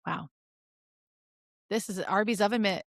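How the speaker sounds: ordinary talk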